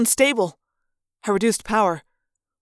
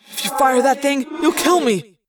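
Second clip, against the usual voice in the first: raised, higher creature voice; pitched-up, pressing protest; alarmed warning; 4 semitones higher than usual. Acted. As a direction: warn them off urgently. Creature warns as gun is aimed.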